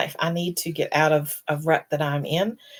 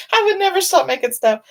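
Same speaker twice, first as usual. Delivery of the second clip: laughing quote; 14 semitones higher, 7 dB louder than usual. Retold or imitated. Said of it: "She said".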